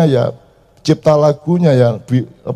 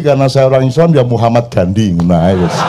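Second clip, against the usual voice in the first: usual speaking voice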